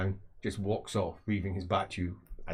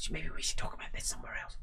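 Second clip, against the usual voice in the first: whispered suggestion; 4 semitones lower, 7 dB quieter than usual. Acted. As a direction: whisper it so nobody hears. Suggesting they move away.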